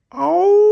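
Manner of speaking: a sudden, very high, loud yelp of pain; pained cry. Acted. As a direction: yelp out as if hurt in the dark. Strange noise in dark forest.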